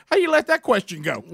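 playful high reporter jab; teasing reporter. Retold or imitated. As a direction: needle him like a reporter who caught a slip. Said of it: "the reporter's like".

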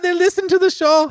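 high, hammed-up German accent; jokey German-accented line about German listeners; mock German accent. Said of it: "My German's spot on".

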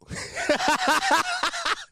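a long, high burst of laughter; high-pitched laugh. Real, not acted. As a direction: crack up at your own joke. Cracks up at own joke.

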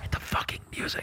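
urgent mock whisper; imitating Finn. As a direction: hiss the order under the breath, barely voiced. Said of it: "Finn was like".

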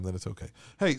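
usual speaking voice

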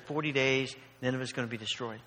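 low, subdued delivery, falling away; unenthusiastic Jonah. Retold or imitated. Jonah preaching doom to Nineveh, unenthusiastic. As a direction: preach it with little enthusiasm. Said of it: "I don't picture Jonah doing that with great amount of enthusiasm".

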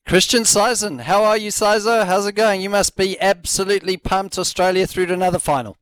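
high-pitched, excited radio greeting; pumped-up welcome. Real, not acted. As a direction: welcome the guest with lifted, eager energy. Host greets guest after hyped intro.

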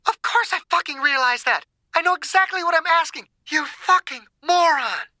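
high, tense exasperated outburst; furious retort. Acted. Margaret cursing the President over his question, furious. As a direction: swear back in high-pitched fury, slowing on the insult.

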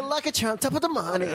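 high-pitched cartoon Irish greeting. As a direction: do a corny mock Irish accent. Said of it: "stereotypical stuff".

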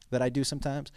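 ordinary talk, nothing marked

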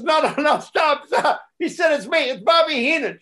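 high, urgent mimicry of calming someone; retold frantic reassurance; hurried backtracking. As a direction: rush to call off the prank. Prankster hurries to calm panicked mother.

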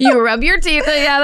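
high, giggly playful instruction; laughing. Pretend. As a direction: say it through laughter. Laughing along with handshake bit.

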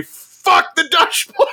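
high, drawn-out imitated shout; mock rallying yell. Standing up in his jacket when Blue Knights go on, mock yell. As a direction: yell it slowly like a chant from the stands. Shouted punchline of the acted vow.

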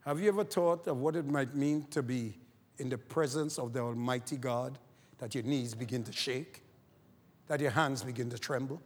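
usual speaking voice

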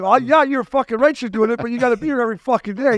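high-pitched mock telling-off; retold angry yelling. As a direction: act out laying down the law in a phone row. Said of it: "I'm yelling at him".

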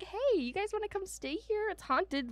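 chirpy sales pitch for a haunted stay; mock owners. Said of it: "So they're like".

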